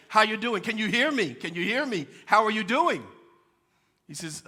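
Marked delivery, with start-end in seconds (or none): 0.1-3.0 louder, pushy repeated call; insistent voice. Retold or imitated. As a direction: call out again, louder each time. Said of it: "the voice says it again".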